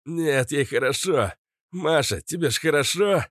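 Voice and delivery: high, mildly winded exclamation; breathing heavily. A narrator's reading. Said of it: "тяжело дыша произнёс Резвый". (тяжело дыша, высоким голосом)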